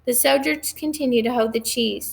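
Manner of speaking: usual speaking voice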